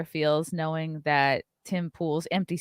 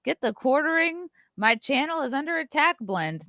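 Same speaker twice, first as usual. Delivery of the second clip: high, mock sales-plug voice; hammed-up promo imitation; imitated coffee pitch; 7 semitones higher than usual. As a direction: push the product in a raised voice. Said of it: "he's pushing his coffee, like".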